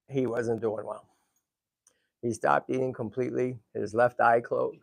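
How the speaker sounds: low, subdued bad-news voice; sombre. Real, not acted. Owner telling viewers his tortoise fell ill, sombre and subdued. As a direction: break the sad news quietly.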